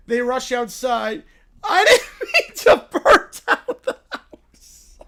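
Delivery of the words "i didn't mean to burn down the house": high bear voice breaking into laughter; little bear apology cracking up; laughing through the line. Acted.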